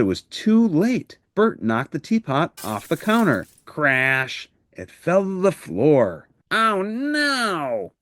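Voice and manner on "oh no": high, sudden cry of dismay. Acted. Bert cries out after knocking the teapot off the counter, high dismayed voice. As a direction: gasp it out as the teapot smashes. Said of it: "cried Bert".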